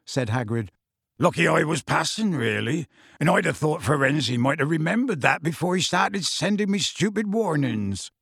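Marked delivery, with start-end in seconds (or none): none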